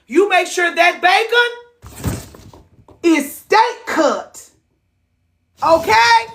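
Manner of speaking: high, slow, pointed order; sing-song high and drawn-out; emphatic high insistence. Acted. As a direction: drive it home sweetly but firm. Insisting on steak-cut bacon.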